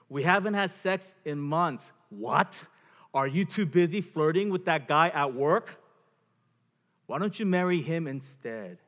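loud, accusing spouse in a quarrel; accusation. Acted. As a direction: fire it at your partner as an accusation. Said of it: "It's an accusation".

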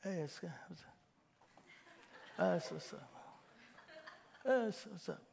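flat, barely audible what's up; bored mumbled greeting. Shown as a demonstration. Pastor mimics a dull church greeting, mumbled and bored. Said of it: "I don't want this".

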